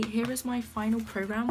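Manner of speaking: ordinary talk, nothing marked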